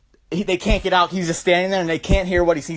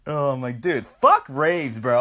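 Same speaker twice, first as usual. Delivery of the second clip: ordinary talk, nothing marked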